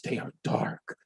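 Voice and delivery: hushed, unvoiced whisper on they are dark. Shown as a demonstration. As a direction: breathe it like a dark secret. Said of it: "spooky contrast on repeat".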